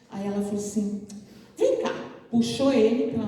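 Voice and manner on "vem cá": put-on much higher, bright childlike call; little girl calling. A little girl calls the sad student to follow her, high and eager.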